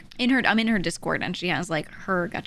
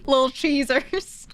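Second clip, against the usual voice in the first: higher, slow, giggly delivery; laughing; 5 semitones higher, slower than usual. Real, not acted. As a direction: savor the silly name with giggles. Giggling over the silly name.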